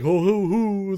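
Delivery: high, loud jolly hoo-hoo; happy grub dad chuckle. Retold or imitated. Guest imitates the grub father's happy noises, jolly chuckle. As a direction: chuckle like a pleased fat bug. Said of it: "those cute little noises".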